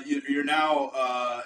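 usual speaking voice

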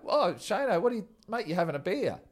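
mock surprise. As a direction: fake shock, winding up a mate. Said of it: "I go".

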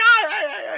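high, gleeful yelping "ah ah ah"; mock excited cries. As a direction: yelp like an overjoyed wrestler. Mimicking excited Uso's cries.

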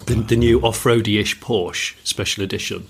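normal talking voice

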